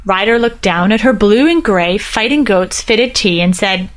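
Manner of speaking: ordinary delivery, nothing marked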